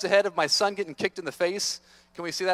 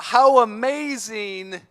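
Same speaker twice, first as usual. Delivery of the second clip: slow awestruck; 2 semitones higher, 4 dB louder, much slower than usual. Real, not acted. Drawn-out wonder.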